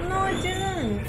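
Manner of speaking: high, slow emphatic denial; insistent. Real, not acted. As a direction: dig in, drawn out and high. Insisting in playful argument.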